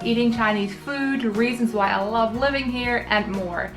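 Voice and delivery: ordinary talk, nothing marked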